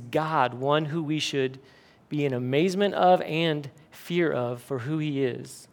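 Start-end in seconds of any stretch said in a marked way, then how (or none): none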